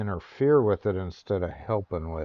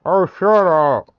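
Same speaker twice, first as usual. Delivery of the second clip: annoyed shout; 5 semitones higher, 9 dB louder than usual. Real, not acted. Yelling at a noisy horse.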